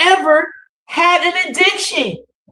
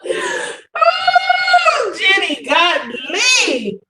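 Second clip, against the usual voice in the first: cracking up in delight; delighted shout; 7 semitones higher, much slower than usual. Real, not acted. Cohost reacting to the exaggerated rant, shouting with delight.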